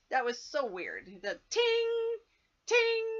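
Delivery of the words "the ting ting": high held tings like piano keys; high piano-note tings; piano ting imitation. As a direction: hold each ting high like a creepy piano. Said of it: "just like the piano".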